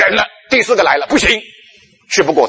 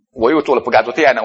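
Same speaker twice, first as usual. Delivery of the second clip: ordinary delivery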